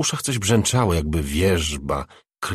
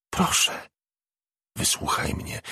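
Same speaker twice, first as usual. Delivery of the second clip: breathy plea; 4 dB quieter than usual. A narrator's reading. Spirit pleads with Angie.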